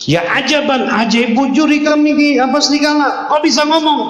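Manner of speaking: very high, amazed cry; astonished shepherd. Acted. Shepherd marvels at the talking wolf, very high and astonished. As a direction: gasp it out in disbelief, voice soaring.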